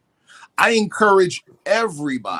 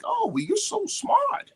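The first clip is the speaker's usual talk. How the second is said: much higher and slow, mock-sweet compliment; a sugary put-on of a well-meaning stranger; imitating well-meaning European; slower than usual. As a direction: play the nice European, high and drawn out. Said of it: "They're like".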